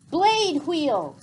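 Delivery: loud battle command. A narrator's reading. Salavet commanding the bats into formation, loud order. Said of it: "commanded Salavet".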